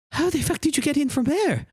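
put-on high, startled young woman; tiefling surprised by an intruder. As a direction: snap round in shock. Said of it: "She turns around and goes".

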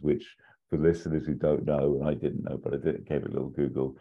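ordinary talk, nothing marked

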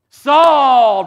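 loud, high auctioneer shout; shouted "Sold". Pretend. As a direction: bring the hammer down with your voice. Auction gavel moment.

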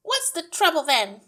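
high, loud put-on voice, hostile; glaring. A narrator's reading. Wife glares at the teacher, hostile. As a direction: demand it crossly in a high voice. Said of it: "glared at her and said".

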